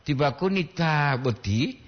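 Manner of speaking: "taboti" with a long "taa"; taa-boti, first vowel stretched; long double A. Shown as a demonstration. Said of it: "Dua A".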